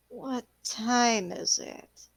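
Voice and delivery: slow, drowsy half-awake murmur; just woken, dragging out each word; groggy waking voice. Acted. Roquefort waking up, asking the time, slow and drowsy. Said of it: "And he says in Sylvan".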